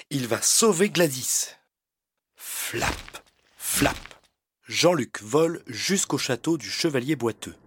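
ordinary delivery, nothing marked

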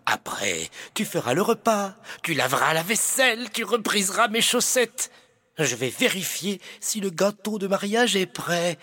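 smug high villain with a giggle; villainous knight. Acted. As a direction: gloat like a pantomime baddie.

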